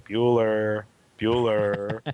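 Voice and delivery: flat, droning roll call; deadpan monotone. Pretend. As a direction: drone it like a bored teacher. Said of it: "talk with a straight face and steady emotion".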